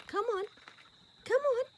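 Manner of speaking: high sing-song call to the horse. Retold or imitated. Calling her horse up the embankment, high coaxing call. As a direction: coax him up in a high voice.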